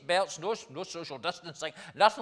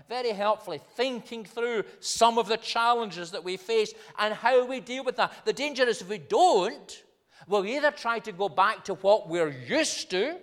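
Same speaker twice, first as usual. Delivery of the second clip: higher, forceful pulpit delivery; urgent preaching; 7 semitones higher, 7 dB louder than usual. Acted. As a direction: drive the warning home from the pulpit. Preaches with raised voice.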